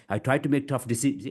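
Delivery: fast run-on, stumbling off before the end; rushed. Shown as a demonstration. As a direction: hurry through with no chunks. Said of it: "If I try to rush".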